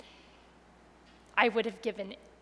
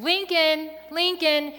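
calling out loud and high, retelling the search; mild retold holler for the lost child; hollering a name; 6 semitones higher, 6 dB louder, slower than usual. Mother searching the street calls her son's name loudly. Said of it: "I go down one street hollering".

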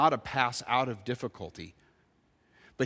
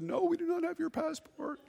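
clerk voiced; 13 semitones higher, 9 dB quieter than usual.

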